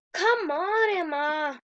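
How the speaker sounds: loud, urging herself on; a loud call to hurry herself. Real, not acted. Girl playing a game urges herself on, loud call.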